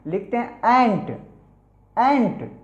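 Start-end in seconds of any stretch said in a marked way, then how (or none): none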